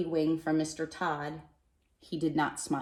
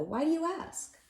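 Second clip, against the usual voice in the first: higher, rising and curious; puzzled; 11 semitones higher than usual. Acted. Mister Hatch asking the postman why, puzzled and higher. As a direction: ask as if caught off guard.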